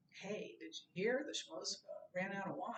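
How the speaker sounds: soft, offhand passing on news; casual gossip. Retold or imitated. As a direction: drop it lightly like idle gossip. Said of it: "More than".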